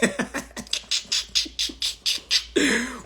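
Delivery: airy, voiceless wheeze of laughter; breathy laughing. Real, not acted. Laughing at his own rant.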